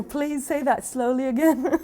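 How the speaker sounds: amused imitation of her own request; sheepish request cracked by laughter; laughing plea. Laughing at her own language trouble.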